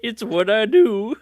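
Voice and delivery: lightly put-on showing off; mock bashful boast. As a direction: play a hint of false modesty. Voicing a fighter showing off.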